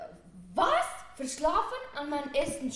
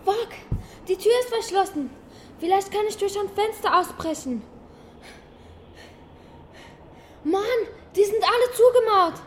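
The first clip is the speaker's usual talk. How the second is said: panicked; 3 semitones higher, 8 dB louder than usual. Acted. Trapped girl searches for escape.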